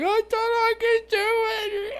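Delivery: very high, slow mock wail; crying little kid. Mocking a kid strapped in the simulator, tearful child voice. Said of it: "this fucking little kid who's like".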